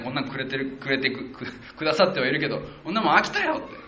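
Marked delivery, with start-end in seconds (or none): 2.8-3.5 fed-up grumble. Retold or imitated. Israelites grumbling about manna.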